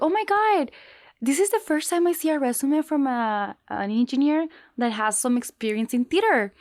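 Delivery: high, excited recruiter voice; squealing over the resume; gushing amazement. Retold or imitated. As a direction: gush like an impressed recruiter. Girl amazed by her resume.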